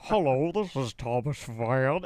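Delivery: mock-formal phone voice, slow; very serious. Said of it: "he's very serious".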